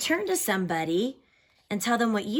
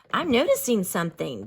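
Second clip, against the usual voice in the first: usual speaking voice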